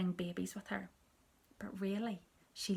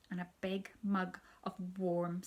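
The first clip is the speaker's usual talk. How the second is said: ordinary delivery, nothing marked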